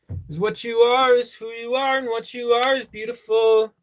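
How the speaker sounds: mock tender. Mock romantic reading.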